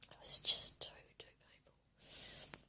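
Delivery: hushed heartbroken whisper; barely voiced sorrow. Real, not acted. Speaking of painful memories.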